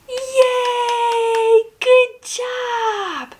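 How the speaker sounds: high-pitched drawn-out praise; bright sing-out of celebration, high; excited cheer. Pretend. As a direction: cheer on a small child. Cheering child after barn task.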